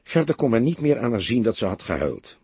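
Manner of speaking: ordinary delivery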